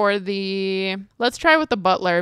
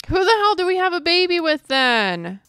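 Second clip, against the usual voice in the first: exasperated; 8 semitones higher, 4 dB louder than usual. Real, not acted. Exasperated over missing butler.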